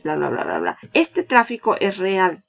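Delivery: ordinary delivery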